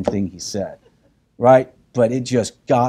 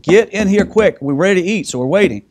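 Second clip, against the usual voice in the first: loud, higher call across the house; 7 semitones higher, 5 dB louder than usual. Retold or imitated. Father calling sons from the other room, loud call. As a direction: summon them to the table.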